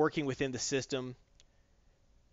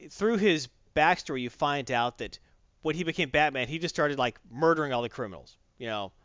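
plain reading voice

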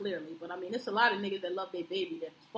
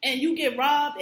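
emphatic outrage; 5 semitones higher, 9 dB louder, slower than usual. Real, not acted.

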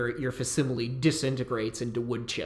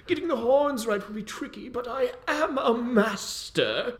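musing mage; 10 semitones higher than usual. Acted. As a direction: murmur to yourself while sculpting. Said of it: "and he says".

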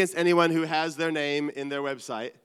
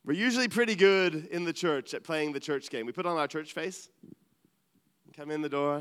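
ordinary talk, nothing marked

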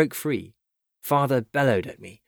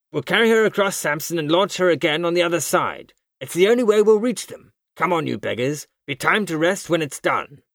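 high, forceful rallying shout; commanding cry; 7 semitones higher, 4 dB louder than usual. A narrator's reading. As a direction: drive the exhausted crew on. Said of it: "he cried".

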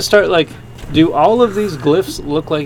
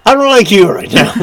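louder, laughing fake annoyance; laughing mock anger; 12 semitones higher, 8 dB louder than usual. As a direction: pretend to be mad while laughing.

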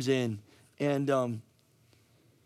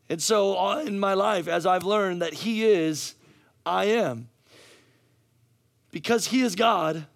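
higher, emphatic preaching; 6 semitones higher, 3 dB louder than usual. Real, not acted. Preacher testifying to the congregation, raised emphatic preaching. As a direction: drive each truth home to the congregation.

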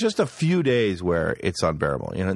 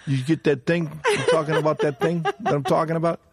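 pitched-up mock Italian-American mobster, laughing through it; Italian wiseguy voice; 8 semitones higher than usual. Teller demonstrating how Italians are supposed to say it. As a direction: ham up the Goodfellas hint-hint delivery. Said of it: "You know how Italians go".

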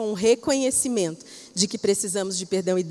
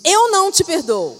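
sharp, louder mock servant refusing; louder, higher, half-acted servant's refusal; retold refusal; 6 semitones higher, 6 dB louder than usual. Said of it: "e disse".

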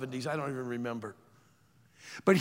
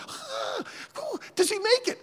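excited student; 12 semitones higher than usual. Retold or imitated.

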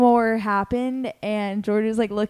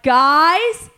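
loud raised call across the house; 6 semitones higher, 8 dB louder than usual. Retold or imitated. Calling the roommates from the couch, loud alarmed call. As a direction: call for them, louder each time.

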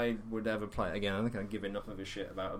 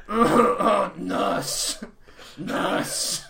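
hammed-up cry for a nurse; ailing Rutger Hauer; 8 semitones higher, 7 dB louder, much slower than usual. Dying actor calling for help mid-recording, mock agony. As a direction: call out in mock agony, high and breaking. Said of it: "it would be Rutger Hauer, like".

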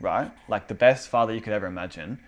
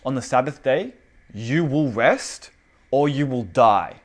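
slow, emphatic decree; mock threatening; 4 dB louder, slower than usual. God ordering slaves to rest on the Sabbath, threatening. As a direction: lay down the law word by word, acted. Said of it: "he's threatening you with death if you don't do it".

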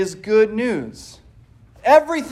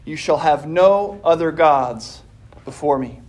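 solemn scripture reading; 4 semitones lower than usual. Acted. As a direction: read it with reverent weight. Reads Scripture opening the sermon.

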